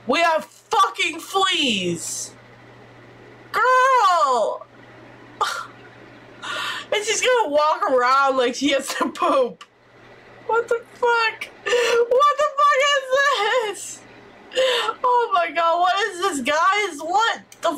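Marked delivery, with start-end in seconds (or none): 3.5-4.6 exasperated yell. Real, not acted. Gamer wailing at her sim over fleas. 10.4-14.0 shocked outburst. Real, not acted. Gamer shocked at what her sim does.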